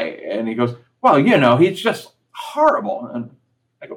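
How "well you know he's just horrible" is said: high, loud imitation of mom's disdain; imitating mom. Son imitating his mom dismissing the actor, high and loud. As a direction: mimic mom dismissing him, pitched up and loud.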